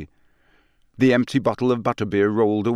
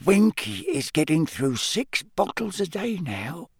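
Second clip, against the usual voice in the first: hushed elf voice; 8 semitones higher than usual. A narrator's reading. Dobby confides to Harry in a hushed high elf voice. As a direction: confide it under the breath, like a small elf. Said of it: "Dobby whispered to Harry".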